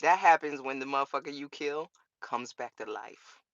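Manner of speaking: ordinary talk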